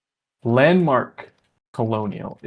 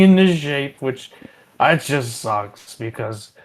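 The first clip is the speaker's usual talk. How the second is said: lightly voiced drunk janitor, louder at first; a hint of a rambling barfly spilling secrets; drunk Larry rambling; 4 dB louder than usual. Drunk Larry rambling to the players at the bar, lightly voiced.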